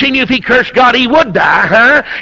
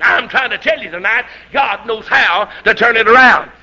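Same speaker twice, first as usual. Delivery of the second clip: usual speaking voice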